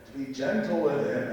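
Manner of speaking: ordinary delivery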